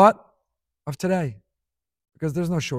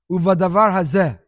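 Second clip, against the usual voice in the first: Uvadavar hazeh proclaimed loud; loud declaiming; 9 dB louder than usual. Real, not acted. The preacher quoting Moshe's rebuke, loud declaiming. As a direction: ring out the verse.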